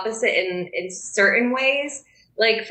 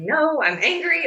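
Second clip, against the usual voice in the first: higher-pitched imitation of a worked-up partner; mock riled up; 7 semitones higher than usual. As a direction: playfully mimic his anger, without shouting. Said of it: "like, riled up".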